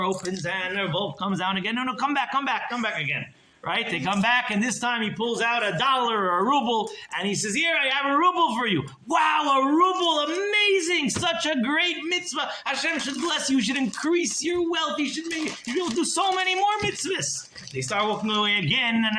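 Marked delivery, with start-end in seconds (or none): 1.8-3.2 higher, eager wait; calling back. Acted. Miser calling the rabbis back once more, higher. Miser calls them again. 7.5-8.9 much higher, loud proud offer; proud miser. Acted. Miser proudly offering a ruble, higher and louder. 9.1-17.5 much higher, gushing delight; effusive praise. Acted. Rebbe gushing over the miser's ruble, much higher.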